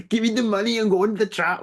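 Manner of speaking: rushed, hammed-up action-hero German accent; Schwarzenegger. Shown as a demonstration. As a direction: toss off a cartoonish German strongman line. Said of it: "I did Schwarzenegger".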